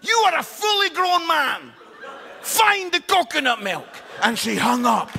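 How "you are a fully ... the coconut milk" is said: high, loud furious shout down the phone; angry wife yelling. Retold or imitated. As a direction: bark it at him like an exasperated wife.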